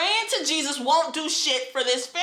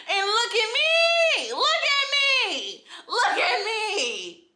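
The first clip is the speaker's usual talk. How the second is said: slow, high, drawn-out yell; angry yelling; 8 semitones higher, slower than usual. Real, not acted. Survivor demanding viewers look at her, yelling angrily. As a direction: stretch each cry out high and impassioned. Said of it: "I've been yelling".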